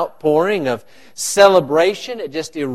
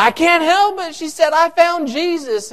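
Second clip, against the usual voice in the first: much higher, ecstatic mock cry; excited woman; 11 semitones higher than usual. Woman in church caught by the Spirit, cries out ecstatically. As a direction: squeal it out like she's overcome with joy. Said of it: "she started yelling out".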